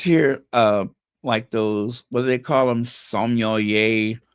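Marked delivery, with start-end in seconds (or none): none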